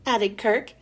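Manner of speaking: plain reading voice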